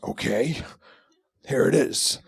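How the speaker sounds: put-on voice of a stubborn actor. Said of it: "a higher voice".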